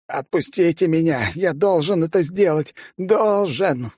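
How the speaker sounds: high frantic shouting; desperate yelling. A narrator's reading. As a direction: fight to break free, yelling. Restrained man pleads. (отчаянный крик)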